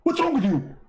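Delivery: high, brushed-off mock voice; mocking Zakir Naik voice. Preacher to visitor at the door, mock high voice. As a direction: play a busy preacher waving him away.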